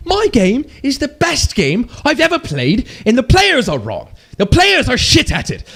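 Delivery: high-pitched mocking developer voice; deluded proud developer. Streamer parodying developers who love their own game, high mock voice. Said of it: "drinking their own, uh, proverbial Kool-Aid".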